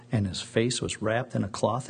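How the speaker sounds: usual speaking voice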